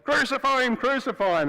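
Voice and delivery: loud, high chant of an angry mob; crowd yelling. Retold or imitated. Mob demanding crucifixion from Pilate, loud high chant. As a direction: bark it out like mad dogs. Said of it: "they just kept yelling".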